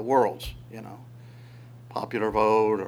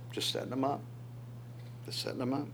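soft, knowing murmur; quiet aside as God; 12 dB quieter, faster than usual. Acted. Preacher voicing God to the congregation, softly and knowingly. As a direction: let God confide it under the breath.

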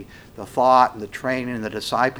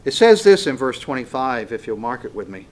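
ordinary delivery

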